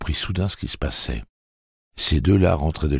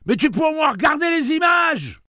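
angry shout; 4 dB louder than usual. A narrator's reading. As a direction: fling the insult at him at full volume. Girl keeps yelling insults.

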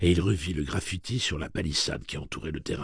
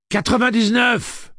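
high, slow yell; loud urgent answer; shouted guess; 9 dB louder, slower than usual. A narrator's reading. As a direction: shout the number through a door. Eddie shouts a guess through door.

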